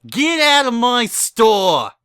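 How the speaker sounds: high, loud shriek drawn out slowly; a shop owner screams at intruders to leave. Acted. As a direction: shriek them out of the store. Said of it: "shrieked Bon Bon".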